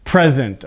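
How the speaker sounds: "Present" stressed on the first syllable, PREZ-ent; noun stress PRESent. Shown as a demonstration. Teacher finishes the contrast with the noun form, first syllable stressed. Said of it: "you can hear the difference in my voice".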